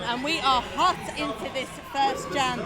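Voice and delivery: loud, driving live commentary; play-by-play commentary. Acted. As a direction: call the action as it happens.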